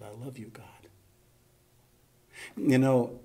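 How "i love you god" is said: very soft murmured prayer; under her breath. Retold or imitated. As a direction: imitate a dying woman's faint whisper. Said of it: "heard her whisper under her breath".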